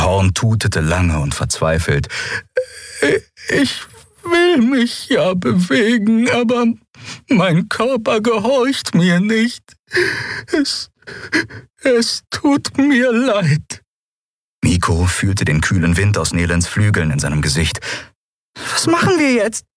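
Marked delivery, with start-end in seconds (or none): 3.5-13.8 very high, slow and helpless; timid little Yadi, lost and pleading; small fairy creature voice. A narrator's reading. Small creature Nelen admits to Miko she's helpless. 18.7-19.6 very high, anxious peep; squeaky fairy voice. A narrator's reading. Frightened Nelen asks Miko what to do, squeaking. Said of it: "piepste sie".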